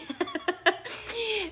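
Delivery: bursting high laugh; high real laugh. Real, not acted. Caught out by her own worry about sister, high laugh. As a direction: laugh at yourself, delighted.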